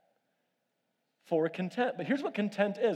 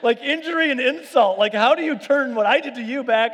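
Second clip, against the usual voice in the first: high, loud, laughing indignation; laughing hammed-up disbelief, slowed at the start; mock outrage; 9 semitones higher, 10 dB louder than usual. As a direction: squeal it out through laughter, much higher and louder. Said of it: "I'm like".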